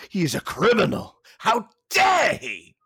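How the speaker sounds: hammed-up indignation, climbing high and loud; mock outrage. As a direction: play the scandalised objector, stretching the final words. Mocking people offended by accents.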